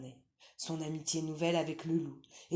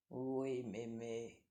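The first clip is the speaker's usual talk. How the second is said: low, soft, slow sly wolf; wolf pretending to obey; wolf faking sweetness; 5 semitones lower, 6 dB quieter, much slower than usual. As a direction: purr it like a cunning wolf.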